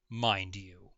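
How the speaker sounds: normal talking voice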